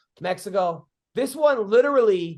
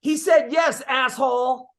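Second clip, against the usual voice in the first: high, louder snapping woman; mock angry lady; 5 semitones higher, 4 dB louder, slower than usual. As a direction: bark it at him like an offended stranger. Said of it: "some lady sitting next to him goes".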